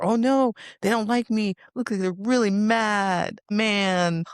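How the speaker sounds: hammed-up worried inner voice; mock anxious. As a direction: play the panicky people-pleaser in your head. Mocks anxious mental clutter.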